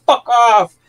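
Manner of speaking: mock shout of a departing driver; retold yell. Retold driver yelling while leaving.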